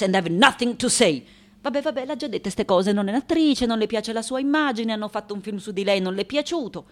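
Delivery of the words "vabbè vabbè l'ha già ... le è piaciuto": very fast, impatient summary; rapid-fire weary interpreting; dismissive translation. Acted. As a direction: rattle it off, fed up with repeats. Fed-up interpreter skims repeats.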